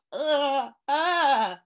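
choked gasping cry; mock choking groans of pain. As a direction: cough it out as if choking. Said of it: "few choking sounds came out of his mouth".

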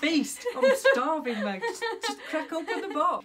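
high, jokey Aussie with laughter; mock Australian accent. Mum urging host to open the box, mock Australian accent. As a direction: ham up an Australian mate, laughing. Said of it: "when it comes to accents".

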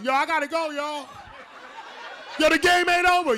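hurried excuse. Pretend. Fleeing the house after clogging toilet.

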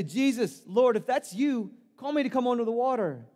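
high, loud call across the water. Acted. Peter calling to Jesus on the sea, loud and high. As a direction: shout it out over the waves, pleading.

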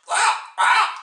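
high, very loud bird squawks; two piercing crow-like cries. A narrator's reading. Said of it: "and it squawked".

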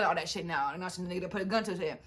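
ordinary talk, nothing marked

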